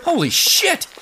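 sudden high shocked outburst; shocked exclamation. Acted. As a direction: blurt it out in shock. Sees giant space prison.

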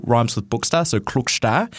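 "Klukstad" said KLUK-star, rhyming with "Bookstar". Shown as a demonstration. Radio host explaining a player's name, careful pronunciation. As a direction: land "Klukstad" on the same vowel as "Bookstar". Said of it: "it rhymes with Bookstar".

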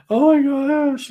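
high, slow tearful wail; mock sobbing, drawn out and high; imitated crying. Woman shocked by two missionaries at her door, imitated wailing. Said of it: "and she's crying and crying".

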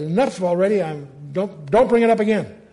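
exasperated God. Retold or imitated. God tells the pestering Moses enough already. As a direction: make God sound sick of the pestering. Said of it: "and the Lord says".

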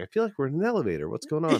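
hammed-up confusion; rushed. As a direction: blurt it out confused. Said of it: "We're like".

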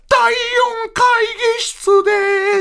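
very high, slow, stretched. Retold or imitated. The duo answering in one voice, like the rock star. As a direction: draw it out in the rock star's tone. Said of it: "確かに今[name]の声やったんですよ".

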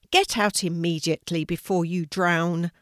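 usual speaking voice

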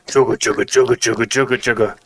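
steady rhythmic chugga-chugga; chugging train. Shown as a demonstration. Showing off his premium train sounds, rhythmic chugging. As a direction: churn along like train wheels. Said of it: "I got the premium train sounds".

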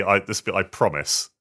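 ordinary talk, nothing marked

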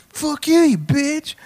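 very high, loud mock heckle; heckler's yell. Heckler cutting into the host's opening, mock angry yell. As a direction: shriek it as an interrupting heckler, hammed-up.

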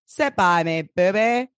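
put-on vintage radio host voice; sixties radio announcer. Host introducing a hit song, radio announcer voice. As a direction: announce it like a sixties chart show. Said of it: "radio announcer voice is".

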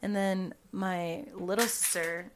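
usual speaking voice

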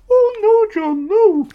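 very high, loud wailing Scottish plea; Scottish producer's anguish. Retold or imitated. Imitating his producer at the Trump interview, high wailing plea. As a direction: wail it like a horrified Scottish woman. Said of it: "And the".

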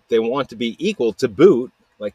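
usual speaking voice

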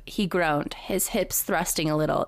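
ordinary talk, nothing marked

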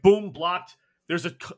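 usual speaking voice

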